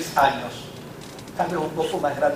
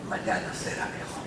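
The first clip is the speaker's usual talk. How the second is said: softly whispered, almost breathless; hushed promise; 9 semitones lower, 10 dB quieter than usual. Acted. Preacher softly whispering hope to the weary listener.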